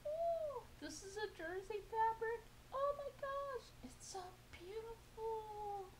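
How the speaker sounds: very high, crying-out squeal of delight; squealy excited voice. Real, not acted. Showing her viewers new fabric, squealing with delight. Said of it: "you guys are gonna get that voice from me".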